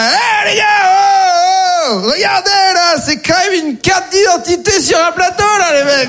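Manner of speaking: high-pitched hammed-up holler to passers-by; calling for attention. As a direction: call out urgently to people far below. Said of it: "que j'attire l'attention des migrants".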